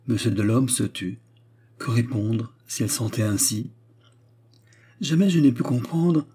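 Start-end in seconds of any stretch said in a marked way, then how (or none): 5.0-6.3 higher, fervent protest; with fire. A narrator's reading. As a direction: take up the argument heatedly. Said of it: "reprit miss Escort avec feu". (avec feu)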